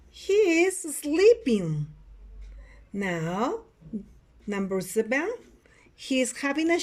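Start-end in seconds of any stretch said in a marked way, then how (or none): 0.1-1.9 slower, high-pitched and stretched; drawn-out model sentence. Shown as a demonstration. Teacher repeats picture six for students, high drawn-out delivery. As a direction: lift the pitch and stretch each word. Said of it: "Repeat after me".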